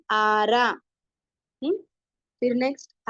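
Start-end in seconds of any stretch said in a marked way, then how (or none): 0.1-0.7 two long syllables in आरा; syllable-by-syllable reading. Shown as a demonstration. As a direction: draw out both vowels of आरा. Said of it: "ध्यान दे और आवाज देखे".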